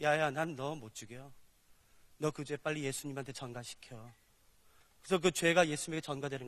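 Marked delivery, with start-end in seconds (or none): 0.0-4.1 gentle God. Acted. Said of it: "그래서 이렇게 말씀하세요".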